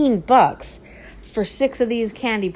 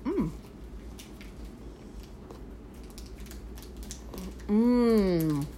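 savoring a bite; eating chocolate; 8 dB quieter than usual. Real, not acted. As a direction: mouth full of chocolate. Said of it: "Let's taste test it".

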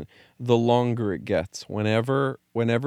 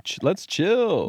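slow high mock drawl; lazy high drawl; 13 semitones higher, 4 dB louder, much slower than usual. Quoting their early attitude.

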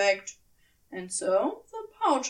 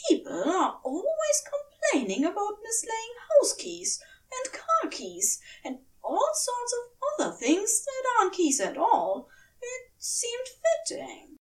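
much higher, fussy angel voice; sheepish admission, voice pitched way up; Aziraphale; 11 semitones higher than usual. A narrator's reading. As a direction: Aziraphale admits it to Crowley, sheepish and high. Aziraphale explaining.

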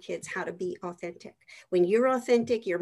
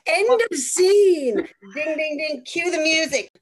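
mock announcer; 5 semitones higher, 8 dB louder than usual. Host joking a mic-drop ending, loud and grand. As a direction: proclaim it like a showman closing the act.

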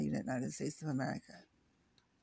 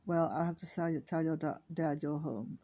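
usual speaking voice

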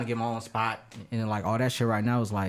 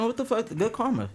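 pitched way up in delighted shock; high surprised exclaim; 12 semitones higher than usual. Retold or imitated. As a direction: yelp it high, happily surprised. Quoting himself, pitch jumps high.

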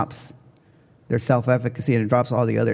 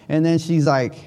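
ordinary talk

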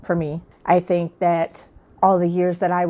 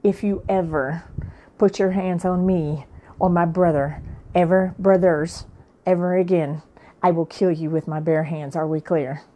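ordinary talk

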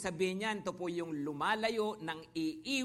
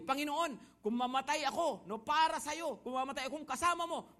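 fervent vow; 6 semitones higher than usual. Retold or imitated. Peter vows loyalty to Jesus.

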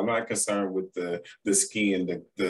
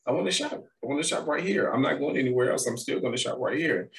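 put-on childish protest, pitched high; teasing the cashier, pitched high; mock protest; 7 semitones higher, faster than usual. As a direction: whine like a kid who wants to shop. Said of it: "I said".

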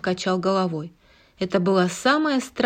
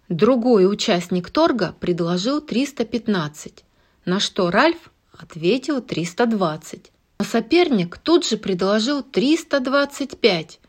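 ordinary delivery

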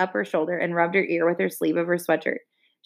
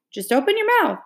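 higher, pressing repeat; insisting; 10 semitones higher than usual. A narrator's reading. Jess insisting Leslie open her mouth, higher. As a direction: push her to just do it.

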